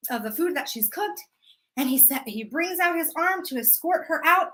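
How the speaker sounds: ordinary delivery, nothing marked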